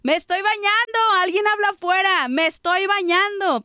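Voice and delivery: lightly acted, high-pitched calling out; imitated calling out. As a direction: call out as mom interrupted mid-bath, without real shouting. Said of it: "nos decía desde el baño".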